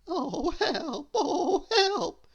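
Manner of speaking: high panicked shriek. Acted. Nervous cabin boy shrieking for help. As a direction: squeal for help in terror. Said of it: "shrieked Mister Jelly".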